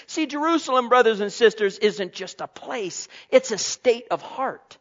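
loud, urgent sermon; preaching at full force. Acted. Pastor urging the congregation at full force. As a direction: press the point home.

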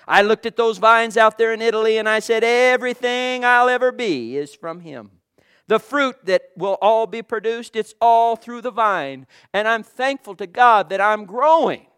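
loud, fast preaching build; rising sermon fervour. Real, not acted. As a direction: preach it out to the congregation. Preaching at full force.

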